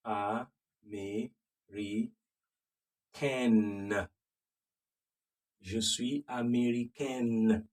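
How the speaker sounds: américaine said slowly with the N clearly voiced; sounded N ending. Shown as a demonstration. Teacher slowly modelling the female form. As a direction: draw out américaine and sound the final N. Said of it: "If it's a female".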